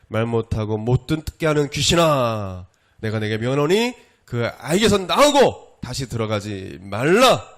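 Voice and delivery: loud command with drawn-out stresses; stern rebuke. A narrator's reading. Preacher reading Jesus' command to the unclean spirit, stern rebuke. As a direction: order it out, bursting loud on the last word. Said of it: "꾸짖어 이르되".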